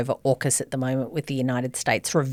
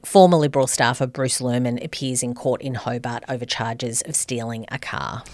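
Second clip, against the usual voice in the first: normal talking voice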